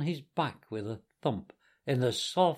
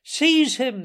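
loud, high-pitched command; horrified cry; 11 semitones higher, 8 dB louder than usual. A narrator's reading. Gentlemen flinging themselves upon Toad, crying out in horror. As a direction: cry out in alarm. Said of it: "they cried".

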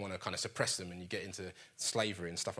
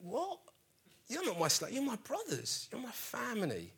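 much higher, startled protest; an appalled brother rejecting their bow, pitched way up; shocked Joseph; 12 semitones higher than usual. Retold or imitated. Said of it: "he must be like".

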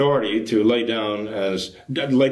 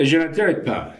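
put-on higher-pitched, poorly accented French; terrible French accent; 4 semitones higher than usual. Correcting the quote from Monsignor Lefebvre, in badly accented French. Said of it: "excuse my terrible accent".

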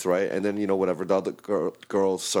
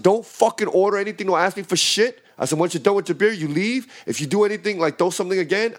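heated, higher-pitched warning; firm retold ultimatum, voice raised in pitch; trying to be stern; 6 semitones higher than usual. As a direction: re-enact laying down the law to a customer. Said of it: "I'm trying to be stern".

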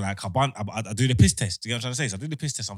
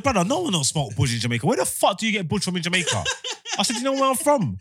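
higher and louder, building outrage; indignant rant; 11 semitones higher, 6 dB louder than usual. Retold or imitated. To the officer, a higher, louder indignant rant. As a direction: tear into him for the insult.

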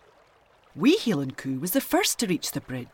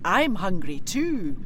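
slow kelpie threat; slower than usual. Acted. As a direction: take your time, savour the threat.

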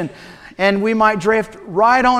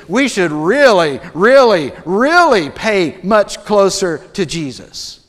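building "really" with the middle one loudest. Shown as a demonstration. Preacher paraphrasing for his church, stacking up emphatic "really"s. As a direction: pile up the "really"s for urgency. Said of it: "put it in the English vernacular".

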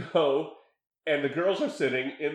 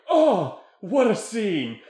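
loud, higher mock-overwhelmed exclamations; imitating out-of-breath excitement; 4 semitones higher, 6 dB louder, slower than usual. Host imitating the shaking director after the shoot, out-of-breath excitement. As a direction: exclaim like a worked-up, winded director. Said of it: "He was, like, out of breath".

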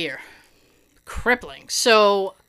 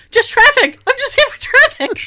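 high, loud flustered blurt; high loud panicky answer with a laugh; nervous kid; 13 semitones higher, 8 dB louder than usual. Retold or imitated. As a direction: blurt it out like a nervous sixth grader. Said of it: "like a sixth grader".

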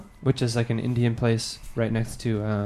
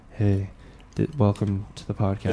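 low voice demo; 5 semitones lower, 5 dB quieter than usual. Shown as a demonstration. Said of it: "My voice is still low".

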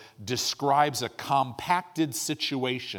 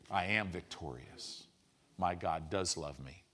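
much lower and softer, inward affirmation; quiet self-declaration; 7 semitones lower, 9 dB quieter than usual. Retold or imitated. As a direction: murmur it to yourself like a private vow. John speaking faith to himself.

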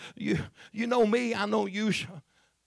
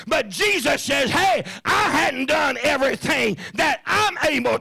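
much higher, shouted preaching; full-force preaching; 9 semitones higher than usual. Acted. Preacher crying out to his congregation, shouting. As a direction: bring the church to its feet.

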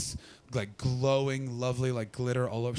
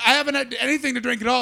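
high, quick, louder retort; high-voiced protest; 11 semitones higher, 5 dB louder, faster than usual. Denying drink to security, high quick protest. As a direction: protest fast in a pushed-up voice.

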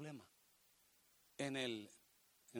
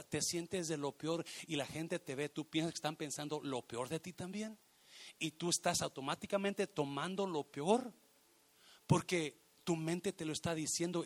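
ordinary talk, nothing marked

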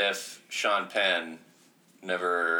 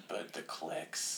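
slow, hushed aside; soft, drawn-out private admission; quiet temptation; 4 semitones lower, 13 dB quieter, much slower than usual. Retold or imitated. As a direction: let it out low and slow, very softly. Imagined editor quietly tempted by clicks.

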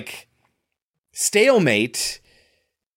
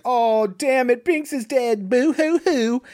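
high, slow mock wail; hammed-up boo-hoo, drawn out and high; fake-cry it in a raised voice; 9 semitones higher, slower than usual. Voices a grieving girl, boo-hoo.

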